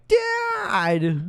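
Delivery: high, loud, whiny put-on cry; mock a whining son calling out; Brendan Fraser. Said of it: "What voice is he doing".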